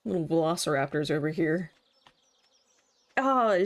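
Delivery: usual speaking voice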